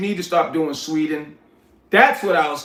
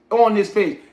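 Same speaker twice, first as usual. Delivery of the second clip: ordinary delivery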